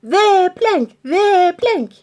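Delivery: high loud yell. Acted. Pirates yelling at the troll, loud yell. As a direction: call out pointing at the plank. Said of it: "yelled Ben Buckle".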